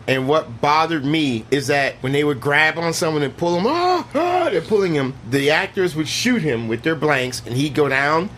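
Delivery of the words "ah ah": high-pitched "ah, ah" yelps; mock pained cries. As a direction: act out being yanked by a dog. Mimics actors pulled by dogs.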